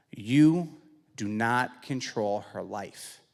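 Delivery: slow, deliberate telling-off; measured words, standing firm; firm command. Retold or imitated. Joy confronts Anxiety at the motherboard, slow and firm.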